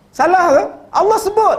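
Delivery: high, loud rhetorical challenge; challenging shout. Real, not acted. Preacher demands of congregation, is it wrong, loud and high. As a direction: fling the question at them.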